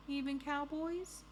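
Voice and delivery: high, slow little question; hopeful thief. Acted. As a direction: ask timidly high, as the storybook thief.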